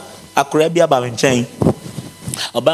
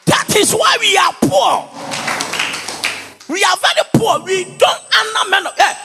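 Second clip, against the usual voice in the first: preaching shout. Acted. Pastor scolding congregation for not honoring men, high shout. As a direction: hammer the rebuke home.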